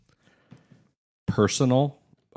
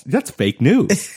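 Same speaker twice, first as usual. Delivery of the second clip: higher, drawn-out mock outrage; mock accusation; 9 semitones higher, 4 dB louder, much slower than usual. Husband retelling his accusation to his wife, mock outrage. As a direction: call it out slowly, pitched up. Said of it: "And I'm like".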